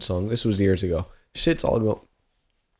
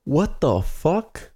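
slow, high, stretched disbelief; drawn-out disbelief; 6 semitones higher, much slower than usual. Real, not acted. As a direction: stretch out the shock. Shocked at the Beetlejuice answer.

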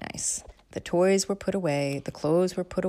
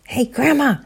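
higher, louder call of Grandma; raised call; 9 semitones higher, 8 dB louder than usual. Acted. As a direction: call out to wake her. Kids calling louder.

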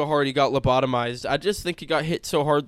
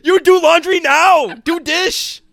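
put-on very loud, high caricature Chinese accent; mock Chinese accent; 13 semitones higher, 10 dB louder, slower than usual. Comic playing himself ordering chores after injury, shouted mock Chinese accent. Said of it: "wake up with a Chinese accent".

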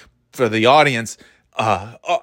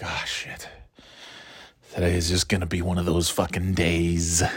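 whispered, much softer groan of dread; dread under breath; 7 semitones lower, 8 dB quieter than usual. Retold or imitated. Waking up dreading the day, whispered to self. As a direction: whisper it, already beaten by the morning. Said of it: "it's like".

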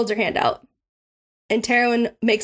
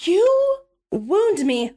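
slow, higher, theatrically hurt; mock wounded; 7 semitones higher, much slower than usual. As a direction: drag it out like she's pretending to be stung. Mock hurt reply to refusal.

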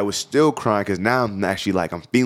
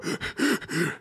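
mock gasp; 10 semitones higher, 7 dB quieter than usual. Acting out girlfriend's panic.

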